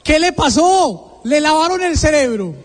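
reproche agudo de madre indignada, imitado; reproche incrédulo. Retold or imitated. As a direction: suelta la pregunta alargada, en tono alto, como regañando. Madre indignada tras la patada. (regaño incrédulo de madre)

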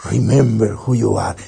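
low, brisker toy catchphrase; imitating a talking toy. A Disney toy repeating the father's line, lightly imitated and low. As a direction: lightly mimic a toy's recorded line, low. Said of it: "lo tocabas y decía".

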